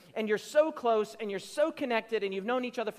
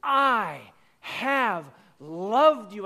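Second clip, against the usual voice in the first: tender declaration; 4 dB louder, much slower than usual. Retold or imitated. Preacher quoting God to his people, slow and tender. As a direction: let it land gently. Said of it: "Malachi records God is saying".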